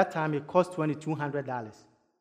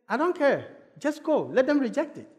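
higher-pitched imitation of a dismissive grandma; grandma's insistent wave-away, acted out high; retold dismissive insistence; 7 semitones higher than usual. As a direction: brush the worry off, pitched up. Grandmother brushing off objection.

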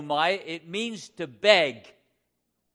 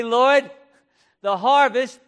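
raised, louder prayerful call; fervent, high-pitched appeal; crying out in prayer; 5 semitones higher, 7 dB louder than usual. Acted. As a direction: call out to God from the pulpit. Preacher voices a prayer crying out.

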